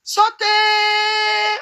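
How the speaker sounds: SOTEY high with a very long held last vowel; stretched emphasis. Real, not acted. Telling how long it went on, Sotey held high and long. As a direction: draw out the end of Sotey on one high note.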